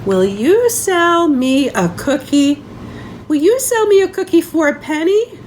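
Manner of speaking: put-on rising, eager little-boy ask; lightly voiced Benny begging for a cookie. Benny asking Lucy May, eager and lightly voiced.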